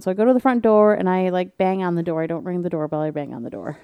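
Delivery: ordinary talk, nothing marked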